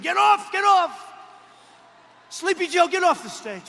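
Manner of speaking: high, loud yelling to get him off; mock screaming handlers. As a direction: scream at him to leave the stage. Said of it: "So they're screaming".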